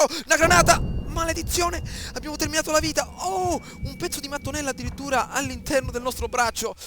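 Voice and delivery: loud, high startled shouts; alarmed shouting. Real, not acted. Gamer hit by a grenade, alarmed shouting. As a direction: yell in real alarm at the blast. Grenade explodes near player.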